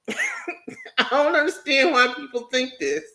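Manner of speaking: normal talking voice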